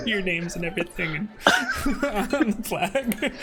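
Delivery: can barely get it out for laughing; real laughter breaking the narration; laughing while talking. Real, not acted. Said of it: "laughing through description".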